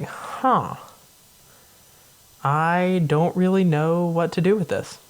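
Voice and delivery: puzzled guard at a loss for what to do; baffled guards. Retold or imitated. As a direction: play a lost, stumped guard. Guards baffled by pope quitting.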